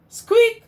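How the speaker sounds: very high loud mouse squeak; tiny squeak. Acted. Mouse squeaks back at Duck, very high and loud.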